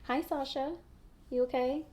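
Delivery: soft high voice for the dog; pet talk. Real, not acted. Talking to her dog.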